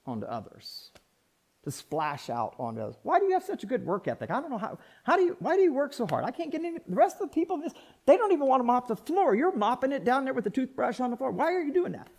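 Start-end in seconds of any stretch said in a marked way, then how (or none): 3.0-12.1 high, rapid-fire incredulous questioning; baffled coworker. Acted. Coworker baffled by the hard worker mopping the floor, incredulous. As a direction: pester with bewildered questions in a higher voice.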